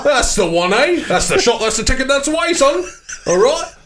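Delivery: high, rising hammed-up barker's patter; comic British barker. Rowdy bloke calling punters to line up outside, high comic shout. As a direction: call the queue in, stretching the last words high. Playing a fairground-style barker in bit.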